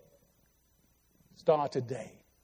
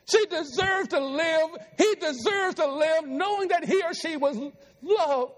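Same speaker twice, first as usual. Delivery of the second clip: preaching shout; 7 semitones higher than usual. Real, not acted. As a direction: cry it out to the whole church. Preacher shouts at full force.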